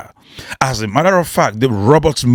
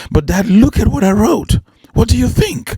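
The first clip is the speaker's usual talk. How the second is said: lightly voiced boy; 8 semitones higher than usual. Boy pleads with father.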